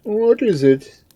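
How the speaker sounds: high, loud, slow lordly voice; a noble lord demanding an answer, high and slow. A narrator's reading. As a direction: pitch it high and draw out the demand. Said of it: "demanded the noble lord".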